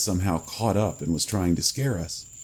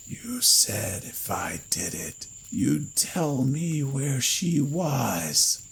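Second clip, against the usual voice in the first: slow whisper rising into a much higher wail; hissed; 7 semitones higher, much slower than usual. A narrator's reading. Cadaver pinning Lloyd, slow rising hiss. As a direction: hiss it, then let it climb. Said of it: "it hissed into Lloyd's face".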